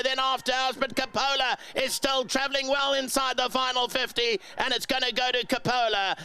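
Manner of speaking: horse-racing commentary, rising steadily to a high climax then dropping; live race call. Real, not acted. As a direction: chant the field rhythmically, pitch climbing toward the finish.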